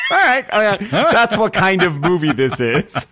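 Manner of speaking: high, laughing realization; all right, got it, delighted; amused exclaim. Retold or imitated. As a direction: burst out laughing at the absurdity. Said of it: "and you're like".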